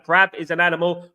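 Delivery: usual speaking voice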